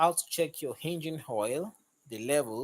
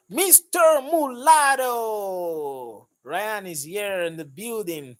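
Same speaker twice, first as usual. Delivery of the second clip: excited welcome; 6 semitones higher, 9 dB louder, slower than usual. Real, not acted. Greeting viewer arriving.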